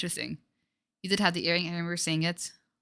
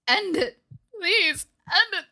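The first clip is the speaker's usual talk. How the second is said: desperate begging; 11 semitones higher, 4 dB louder, slower than usual. Acted.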